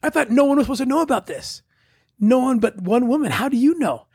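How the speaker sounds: high, startled disbelief; surprised. Retold or imitated. Father telling the woman at the gate, in high surprise. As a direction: jump way up in pitch, caught off guard. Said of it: "I'm like".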